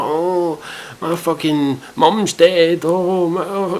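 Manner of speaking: high, exaggerated fake sobbing; hammed-up woe, drawn out; mock wailing. Mimicking contestants' sob stories, mock wailing.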